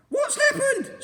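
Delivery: very high, loud scream. A narrator's reading. Missus Twit screaming at the upside-down room. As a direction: shriek in shock. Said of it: "screamed Missus Twit".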